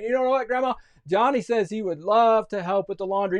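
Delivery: put-on high, sing-song girl's voice; scheming sister. Jenny volunteering Johnny for laundry, high put-on voice. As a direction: offer up someone else too sweetly.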